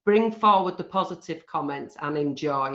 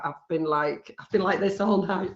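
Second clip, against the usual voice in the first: ordinary talk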